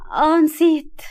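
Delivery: high, loud "oh"; girlish burst of recollection; sudden realization. A narrator's reading. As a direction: blurt it out on remembering. Girl suddenly realises she forgot.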